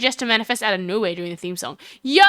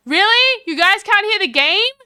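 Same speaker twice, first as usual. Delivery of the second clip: high, loud disbelief; shocked realization; really surprised; 8 semitones higher, 5 dB louder than usual. Real, not acted. Learns chat can't hear game.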